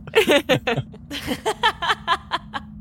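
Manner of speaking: long laugh at own slip; helpless high laugh. Real, not acted. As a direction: crack up, high-pitched laughter. Cracking up at own failed voice.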